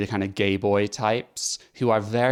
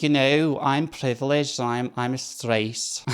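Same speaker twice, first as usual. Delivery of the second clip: high, slow mock posh English accent; 3 semitones higher, slower than usual. Mocking the cis speaker in a posh accent. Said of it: "trying to do the accent".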